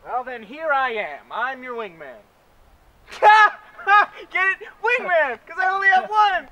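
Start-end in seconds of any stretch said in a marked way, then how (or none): 3.1-4.3 big delighted laugh at own joke; loud laugh. Acted. Angel laughs at own pun. 4.3-6.5 high, loud gleeful punchline; proud of the pun, bubbling over; excited. Acted. Angel proud of wingman pun.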